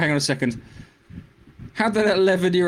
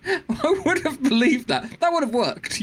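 high, drawn-out laughing remark; 10 semitones higher, slower than usual. Real, not acted. Co-host joking about a plot fix, laughing. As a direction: say it slowly through a laugh. Laughs at own brain damage joke.

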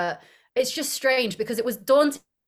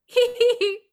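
high, louder hee hee giggle; imitated high giggle; 13 semitones higher, 4 dB louder than usual. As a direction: mimic the director's giggling in the background. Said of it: "you could hear the director going".

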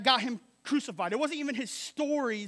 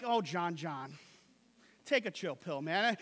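2 mock dismissive; 6 semitones lower, 4 dB quieter than usual. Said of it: "he's not like".

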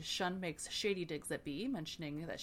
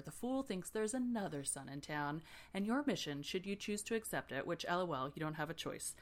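ordinary talk, nothing marked

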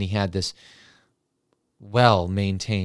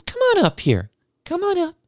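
high, sing-song music teacher cooing into a mic; sugary teacher voice; 3 dB louder than usual. Retold or imitated. Music teacher cooing at a late class, high and sing-song. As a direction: gush like an overeager music teacher.

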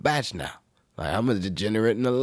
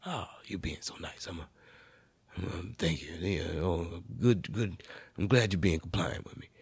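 soft mock cop; 2 semitones lower, 7 dB quieter than usual. Voicing the cop.

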